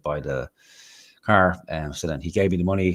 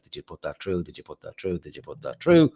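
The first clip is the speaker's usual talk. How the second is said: nagging repeated question; 2 semitones lower than usual. Retold or imitated. Mimicking nagging phone calls.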